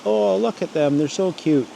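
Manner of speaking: high, gushing voice mocking people who find bears cute; mocking cooing tourist. Vlogger mocking viewers who think grizzlies are cute, high cooing. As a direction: mimic a naive fan cooing over bears. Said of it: "it's generally not".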